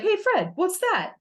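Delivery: high, silly cartoon sidekick voice; high-pitched cartoon Barney asking; Barney impression. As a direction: squeak it out like a goofy caveman buddy. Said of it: "Barney is just like".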